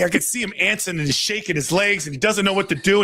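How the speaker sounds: ordinary delivery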